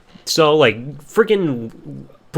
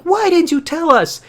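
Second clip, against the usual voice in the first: much higher, louder indignant mother voice; shrill mock mom; 5 dB louder than usual. Mother scolds her son for hiding it, high indignant voice. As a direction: play the outraged mom. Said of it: "And Linda's like".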